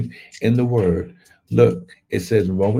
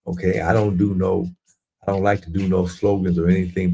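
ordinary talk, nothing marked

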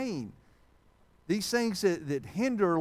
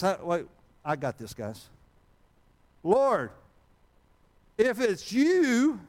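cocky Peter; 4 semitones higher, 5 dB louder, slower than usual. Acted. Preacher voices boastful Peter.

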